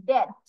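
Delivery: DEATH said as "dead", closing on a hard d; hard d ending. Shown as a demonstration. Teacher telling students how to pronounce this one, DEATH with final d. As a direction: swap the final th of DEATH for a firm d. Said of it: "in this one you have to pronounce".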